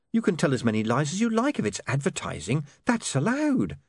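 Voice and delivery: high, brisk-minded persuasion; Saccharissa, insistent. Acted. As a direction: argue the point in a high voice. Narrator voices Saccharissa.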